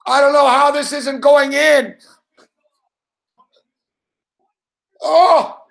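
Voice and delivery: real, rising yells of disbelief, high and loud; yelling at the screen as the puck won't go in; excited shouts. Real, not acted. Streamer yelling at the hockey game, real excited shouts. Watching a near-goal live.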